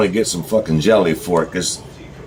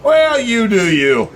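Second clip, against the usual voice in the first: high, slow, far-off sneering voice; mocking "you do you"; 10 semitones higher, slower than usual. Vlogger mocking what people say to him, high and slow sneer. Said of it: "I don't like when people say".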